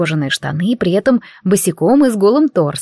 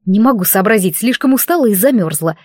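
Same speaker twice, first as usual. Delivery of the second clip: ordinary delivery, nothing marked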